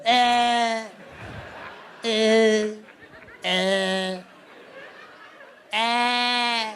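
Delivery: loud croaking caws; crow caw impression. Comedian mimicking a crow laughing, loud caws.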